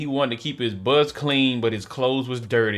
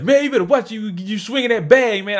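raised, complaining driver voice; a hint of an annoyed bus driver; grumpy bus driver; 9 semitones higher, 4 dB louder than usual. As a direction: scold the kid for swinging his bag. Bus driver scolding the narrator.

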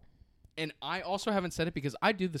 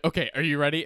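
louder, eager imitation; [name] overexcited; 3 semitones higher, 7 dB louder than usual. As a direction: imitate someone bursting to show something. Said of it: "I get so excited".

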